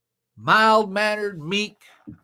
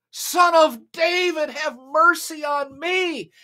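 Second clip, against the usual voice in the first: high, loud, slow plea; louder yell; 8 semitones higher, slower than usual. Acted. Beggar yells louder over the crowd, high and slow. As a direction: drag it out at full volume. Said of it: "But he yelled the louder".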